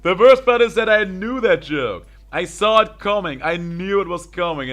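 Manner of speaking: high, loud words through laughter; laughing. Real, not acted. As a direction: still cracking up at the joke. Still laughing at the joke.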